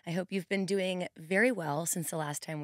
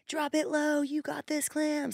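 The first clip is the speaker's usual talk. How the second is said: much higher, pumped-up coach call; hype cheer; 9 semitones higher than usual. Pretend. Trainer playing her imagined hotkey hype quote, high and pumped. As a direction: hype up the class like a recorded cheer. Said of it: "See, I'm not supposed to be doing that".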